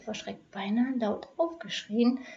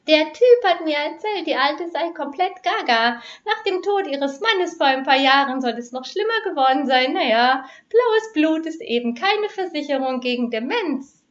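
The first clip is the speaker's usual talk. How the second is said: higher and louder, a cocky crook's voice; a burglar gossiping, pitched up and loud; high-voiced burglar; 7 semitones higher, 6 dB louder than usual. A narrator's reading. Burglar talks in the dark. (Einbrecher, höher und lauter)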